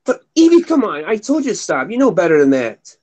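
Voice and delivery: real pet scolding, pitch dropping as it goes. Real, not acted. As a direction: chide a misbehaving pet. Scolding a pet mid-stream.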